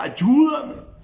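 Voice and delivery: high pleading cry for help; retold shout. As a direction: cry out desperately, then ask. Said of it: "Le gritaba".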